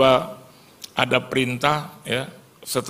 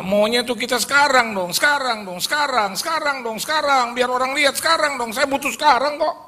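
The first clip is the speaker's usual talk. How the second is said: much higher whining, insistent; whiny now now; 13 semitones higher than usual. Pretend. Preacher mocks impatient believers, high whiny demand. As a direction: demand it like a spoiled child.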